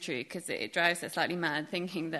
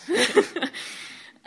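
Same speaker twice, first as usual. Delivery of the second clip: a short breathy burst of laughter; quick unvoiced giggle, high; real laugh; 13 semitones higher, 6 dB louder than usual. Real, not acted. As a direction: laugh at your own joke. Laughs at her own warning.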